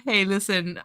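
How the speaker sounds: breathless after laughing; laughed out. Real, not acted. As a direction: recover from laughing. After long laughing fit.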